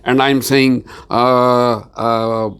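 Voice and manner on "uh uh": drawn-out, faltering "uh"s while searching for an answer; demonstrating a hesitant, evasive answer; unconfident hesitation. Shown as a demonstration. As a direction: stall and fumble as if unsure what to say. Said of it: "Not talking like this".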